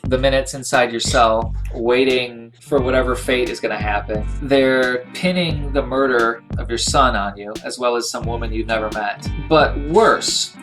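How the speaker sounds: normal talking voice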